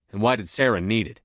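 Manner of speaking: ordinary delivery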